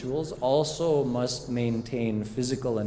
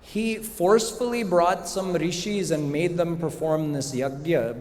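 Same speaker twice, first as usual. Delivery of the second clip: ordinary talk, nothing marked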